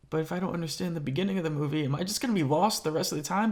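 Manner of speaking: fast, fretting inner voice; anxious rushed thought. Retold or imitated. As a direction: rush it out, fretting.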